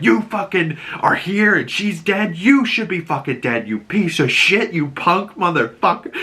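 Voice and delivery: acted angry tongue-lashing, high-pitched; imitated furious dressing-down, pitched well up; giving him the business. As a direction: tear into him in a performed rant. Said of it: "she's just giving him the fucking business".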